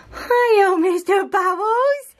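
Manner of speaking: put-on high, slow baby-talk hello; cutesy greeting. Greeting little Mister Bubbles, high slow cooing. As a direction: coo it at a little pet.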